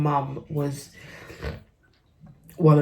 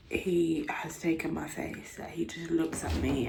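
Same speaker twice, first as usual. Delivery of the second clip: whisper; 9 dB quieter than usual. Real, not acted. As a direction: breathe the words out softly. Whispering beside baby.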